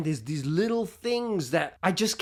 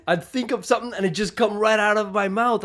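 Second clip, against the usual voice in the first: usual speaking voice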